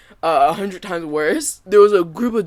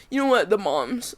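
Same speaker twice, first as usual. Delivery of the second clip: ordinary talk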